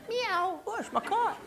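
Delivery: coy cat strolling back in; sweet cat voice. Pretend. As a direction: purr it like an innocent cat.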